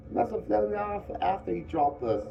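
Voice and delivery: put-on the fair boy, brushing off worry; fair boy, confident. A narrator's reading.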